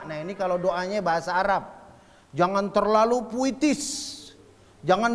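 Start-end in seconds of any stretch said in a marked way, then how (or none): none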